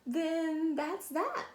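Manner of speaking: slow firm mom; slow final word. Acted. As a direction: settle it slowly. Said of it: "said her mom".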